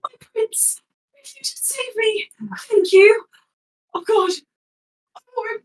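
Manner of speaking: high, pleading yell as a frail captive; [name] yelling. Acted. [name] begs his prince to save him, high pleading yell. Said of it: "he's going to yell".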